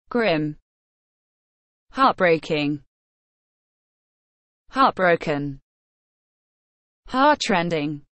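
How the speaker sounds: low, heavy reading of each word; mournful vocabulary recital; somber word list. Acted. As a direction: read every word like it weighs on you. Reads list of sad words.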